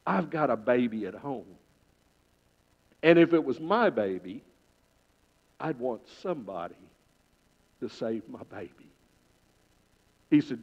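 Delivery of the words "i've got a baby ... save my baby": softer, lower, sincere; young fireman asking the chief, quietly sincere; quiet earnest plea. Acted. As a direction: plead gently.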